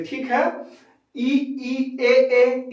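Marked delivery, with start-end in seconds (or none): 1.2-2.7 ई and ए each said twice, clearly; vowel demo. Shown as a demonstration. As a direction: sound out ई, ई, ए, ए for the class. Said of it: "इसका उच्चारण जीभ के अगले हिस्से से".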